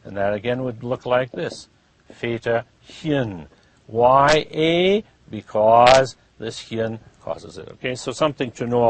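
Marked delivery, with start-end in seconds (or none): 2.1-3.5 "Väterchen" slowly, split before chen; spaced-out "Väterchen"; slow syllables. Shown as a demonstration. Teacher showing how it is written, slow syllables.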